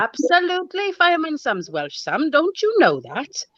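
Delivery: teasing Sam in a hammed-up Welsh accent; mock Welsh accent. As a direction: play a Fireman Sam style Welsh voice.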